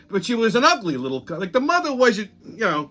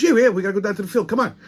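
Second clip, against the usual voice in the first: rapid, higher urging in imitation; impatient colleague; 4 semitones higher, much faster than usual. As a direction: rush the reluctant listener along. Colleague hurrying him along.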